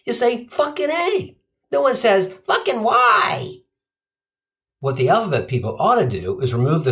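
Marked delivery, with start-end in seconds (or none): 0.5-1.4 high-pitched burst of excitement; really excited. Acted. Comic quoting what excited people say, mock excited. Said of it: "really excited or really pissed". 2.5-3.6 high, drawn-out mock exclamation; mock excited. Comic parodying what no one says, mock excited and drawn out. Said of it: "really excited or really pissed".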